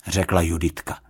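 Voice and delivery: ordinary delivery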